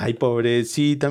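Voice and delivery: usual speaking voice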